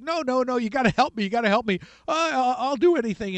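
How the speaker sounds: high, loud mock panic, pleading fast; panicked begging. Haman begs the queen for mercy, hammed-up high panicked pleading. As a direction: beg for your life, hammed up. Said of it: "Haman's in a panic".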